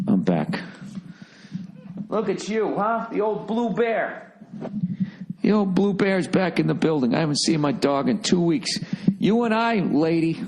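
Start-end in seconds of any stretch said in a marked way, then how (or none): 2.1-10.4 high pet voice for the dog; talking to the dog. Real, not acted. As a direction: fuss over the dog lovingly. Fussing over his dog.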